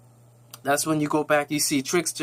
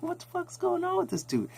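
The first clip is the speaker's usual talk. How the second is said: baffled reaction; 7 dB quieter than usual. Retold or imitated. Own baffled reaction to scene.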